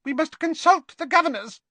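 squeaky little voice, very high; Professor Flitwick. A narrator's reading. Flitwick urges colleagues to consult the governors, squeaky little voice. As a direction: squeak it like a tiny professor, a bit loud. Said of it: "in his squeaky little voice".

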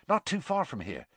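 high, hushed eagerness; excited Seamus. A narrator's reading. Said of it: "who looked excited".

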